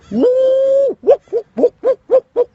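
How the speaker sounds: high loud whoops; wild release cheer; whooping. Shown as a demonstration. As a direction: whoop like you don't care who watches. Demonstrating release yell.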